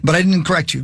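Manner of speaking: ordinary talk, nothing marked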